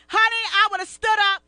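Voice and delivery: loud, sassy declaration; hyped indignation; fired up. Real, not acted. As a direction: get heated and loud. Fired up at the chick.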